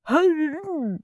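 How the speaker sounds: high-pitched wordless groan; long, pained moan; dazed groan. A narrator's reading. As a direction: groan as if knocked flat. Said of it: "groaned Mister Mossy".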